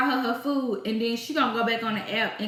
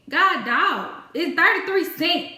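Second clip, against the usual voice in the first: louder, higher, fed up; exasperated outburst; 5 semitones higher, 4 dB louder, slower than usual. Real, not acted. Cashier ranting about a petty customer, fed-up outburst. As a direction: throw it up in disbelief.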